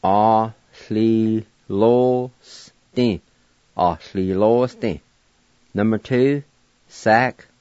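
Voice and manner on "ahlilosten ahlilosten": Ahlilosten said slowly and carefully, a-hli-lo-sten; teacher modelling Ahlilosten; model pronunciation. Shown as a demonstration. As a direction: pronounce Ahlilosten syllable by syllable for learners. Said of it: "it's pronounced like this".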